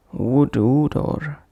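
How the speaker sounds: slow WOOD DOOR with an "oo" between; extra vowel inserted. Shown as a demonstration. Teacher demonstrating a wrong pronunciation, extra vowel inserted. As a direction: stretch an "oo" after "wood", as in "woodu door". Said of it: "avoid bringing any other sound between".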